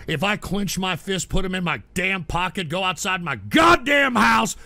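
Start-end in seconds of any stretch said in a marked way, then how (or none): 3.4-4.6 blustering shout. Real, not acted. Boasts of being a badass.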